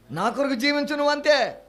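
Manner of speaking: commanding voice of Jesus. Acted.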